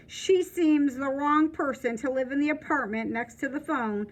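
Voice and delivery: ordinary delivery, nothing marked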